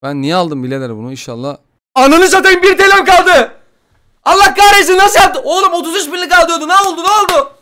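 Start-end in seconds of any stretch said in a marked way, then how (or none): 1.9-7.5 very high, loud shriek; panicked scream. Real, not acted. Gamer sees his money vanish, panicked scream. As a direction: scream in shock at the loss.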